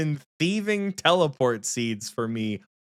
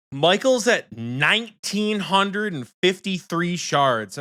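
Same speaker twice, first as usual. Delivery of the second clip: ordinary talk, nothing marked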